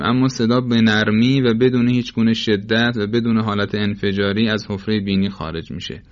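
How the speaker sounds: usual speaking voice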